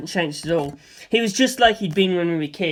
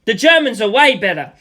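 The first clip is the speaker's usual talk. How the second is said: shouted; 4 semitones higher, 7 dB louder than usual. Acted. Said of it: "I shouted".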